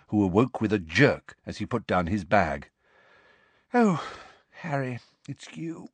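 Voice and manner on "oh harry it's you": bleary. Acted. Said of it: "she said blearily".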